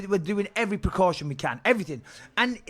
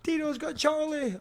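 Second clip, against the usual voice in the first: high, weepy mock sob of a frantic wife; retold crying wife; 9 semitones higher, 4 dB quieter, much slower than usual. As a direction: imitate her crying down the phone, pitched up. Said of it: "my wife crying her eyes".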